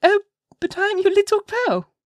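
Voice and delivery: put-on very high, loud little-girl voice; playful child, pitched far up. A narrator's reading. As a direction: pipe up like a small child. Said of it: "answered the child".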